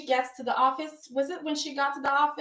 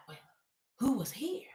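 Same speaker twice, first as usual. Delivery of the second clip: hushed question; 9 dB quieter than usual. Retold or imitated. Bill questioning shaken Liam.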